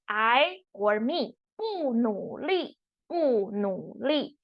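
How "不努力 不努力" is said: 不努力 said slowly and softly, each tone clear; Mandarin phrase said slowly. Shown as a demonstration. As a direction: model 不努力 slowly twice. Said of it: "To not work hard".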